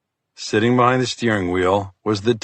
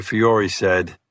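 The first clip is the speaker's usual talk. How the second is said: ordinary delivery, nothing marked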